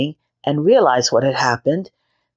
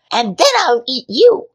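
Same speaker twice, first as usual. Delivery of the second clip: high, louder witch scream; 9 semitones higher, 3 dB louder than usual. A narrator's reading. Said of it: "she screamed at Gretel".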